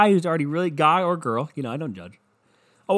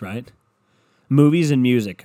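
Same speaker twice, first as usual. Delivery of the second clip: normal talking voice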